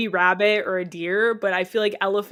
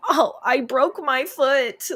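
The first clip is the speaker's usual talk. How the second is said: slow, higher, put-on actress voice; mock dramatic skit line; 5 semitones higher, slower than usual. Student acting a skit in Spanish class, hammed-up slow pained line. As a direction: ham up a fake injury line slowly.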